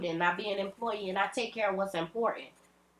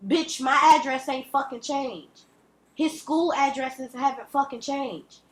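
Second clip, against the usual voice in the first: usual speaking voice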